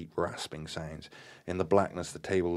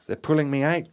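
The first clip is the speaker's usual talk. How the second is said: high, loud cry of terror; terrified shriek; 9 semitones higher, 8 dB louder than usual. A narrator's reading. Gaynor is being dragged away, terrified shriek. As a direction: shriek it out, pitch jumping up. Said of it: "Gaynor shrieked in ter".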